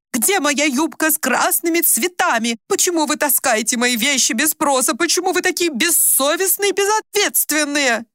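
high, agitated interrogation; angry accusation. A narrator's reading. Mother angrily accuses her daughters.

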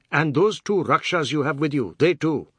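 high, loud accusing warning; indignant threat. A narrator's reading. Biswas threatening the labourers from above, indignant. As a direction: fling the warning down at them.

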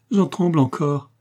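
high, lively, cheerful answer; joyful. A narrator's reading. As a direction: admit it with merry relief. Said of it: "répondit joyeusement Adam". (réponse joyeuse, voix haute)

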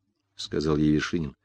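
ordinary delivery, nothing marked

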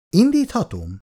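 high eager asking; bursting to start the record; excited question. A narrator's reading. Jutka asks Icu to start the music, high and eager. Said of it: "kérdezte Icutól izgatottan Jutka".

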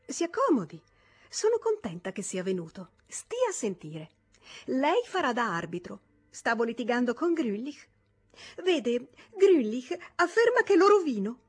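lightly voiced lively young wife; Toni voice. (giovane moglie vivace, voce alzata)

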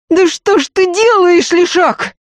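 very high, loud shriek; outraged cry. A narrator's reading. Maid as the robber fells her lady, crying out. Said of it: "вскричала Дунька".

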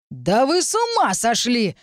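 drawn-out, high outraged cry; yelling. A narrator's reading. Bystander pulling an attacker away, yelling. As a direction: yell it slowly in high-pitched disbelief. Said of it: "завопил кто-то".

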